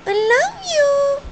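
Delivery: high, loud, stretched parrot voice; parrot impression. Owner copying her own bird's reply, parrot impression. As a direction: screech it slowly, like a pet bird. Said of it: "because Skylar goes".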